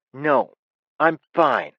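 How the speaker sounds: put-on high, loud sulky child; boy cut short, answering with a loud sulk; grumpy boy. A narrator's reading. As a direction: snap it like a pouting kid. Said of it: "Ben said grumpily".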